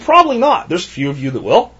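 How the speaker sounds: ordinary talk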